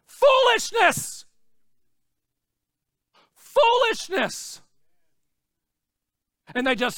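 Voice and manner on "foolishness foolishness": preacher's scornful shout; scornful outcry. Real, not acted. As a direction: spit out the word Foolishness slowly.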